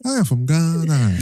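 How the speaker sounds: slow, badly faked Ghanaian accent, laughing; mock Ghanaian accent. Host joking for the sisters in a slow mock Ghanaian accent. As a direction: drag it out in a comic Ghanaian accent. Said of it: "the African accent that you did".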